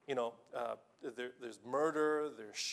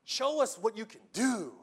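higher, pushy taunt from the crowd; mock them into proving it; a challenging cry for a sign; demanding crowd; 6 semitones higher, 3 dB louder than usual.

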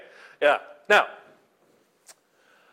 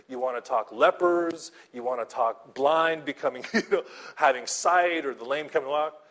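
usual speaking voice

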